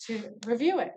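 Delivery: usual speaking voice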